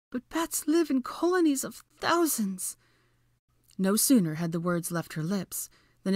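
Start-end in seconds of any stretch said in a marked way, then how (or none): 0.1-2.8 high, alarmed protest; panicked whimper. A narrator's reading. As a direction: wail it in rising dread. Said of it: "Hermione whimpered".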